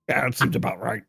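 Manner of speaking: deep deadpan voice. Retold or imitated. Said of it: "he's like".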